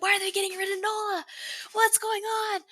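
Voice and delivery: high-pitched indignant outburst, replaying past outrage; mad, retold. Fan recalling being mad about a trade, high indignant protest. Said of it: "I was so mad".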